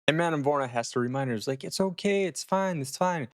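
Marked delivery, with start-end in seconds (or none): 1.6-3.3 high-pitched reassurance, stepping gently down; mock soothing. Madame Vorna calming Kathy about the marriage, soothing. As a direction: talk her down in a higher voice.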